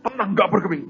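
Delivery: ordinary talk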